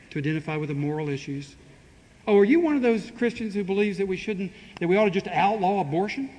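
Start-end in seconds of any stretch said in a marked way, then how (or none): 2.3-6.3 raised, louder voice of disbelief; mock incredulous challenge. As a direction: challenge them as if scandalised.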